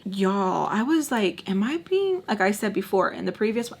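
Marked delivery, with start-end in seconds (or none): 1.5-2.2 high-pitched disbelief; high incredulous aside. Retold or imitated. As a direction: ask it high, as if you can't believe it. Can't believe what he says.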